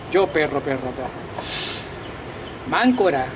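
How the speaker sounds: usual speaking voice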